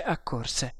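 usual speaking voice

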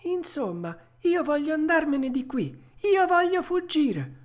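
high, rising determination; insistent puppet. Acted. Puppet tells the tuna he wants out, high insistent voice.

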